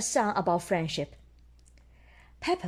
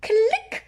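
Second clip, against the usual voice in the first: high loud click; camera click; 14 semitones higher, 6 dB louder than usual. Acted. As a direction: pop it like a camera. Camera sound in story.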